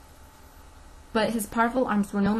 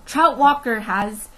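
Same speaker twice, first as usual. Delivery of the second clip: urgent; 3 semitones higher, 9 dB louder, slower than usual. A narrator's reading. Urgent plea cut off.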